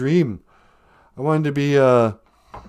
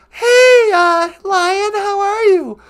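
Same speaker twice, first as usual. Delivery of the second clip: much higher, louder put-on female voice; Natasha voice; 14 semitones higher, 6 dB louder, slower than usual. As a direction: greet him in a bright high character voice. Natasha voice greets the caller.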